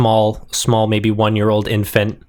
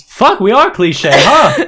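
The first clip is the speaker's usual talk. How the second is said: raised-pitch, louder character voice; Stolas; 8 semitones higher, 5 dB louder, slower than usual. Acted. Game master voices Stolas conceding the cliche, exclaiming.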